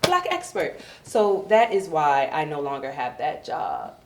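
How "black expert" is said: high, loud laughing mock English voice; snitching British colleague, hammed up; British coworker snitching. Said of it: "He was like".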